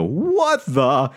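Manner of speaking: high, drawn-out double take; cartoonish disbelief. Two stranded riders gape at the departing bus, cartoonish disbelief. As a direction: stretch it out like a stunned cartoon character. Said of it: "look at each other like cartoon characters".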